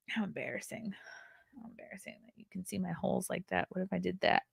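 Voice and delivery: very soft, near-whispered mock shame; mock embarrassment. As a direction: shrink into a playful embarrassed murmur. Feigns embarrassment over exposed holes.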